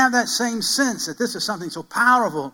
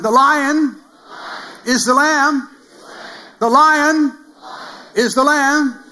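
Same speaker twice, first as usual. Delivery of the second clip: higher and louder, chanting each phrase; loud call and response; 7 semitones higher, 6 dB louder, much slower than usual. Real, not acted. Pastor leading the congregation in a loud chant. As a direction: shout it out for the congregation to echo. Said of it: "Let's try it again".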